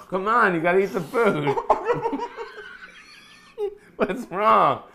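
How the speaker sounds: ordinary delivery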